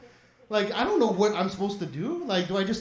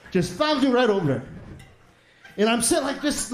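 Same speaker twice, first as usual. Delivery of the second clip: ordinary talk, nothing marked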